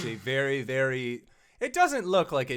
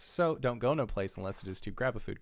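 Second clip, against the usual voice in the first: ordinary talk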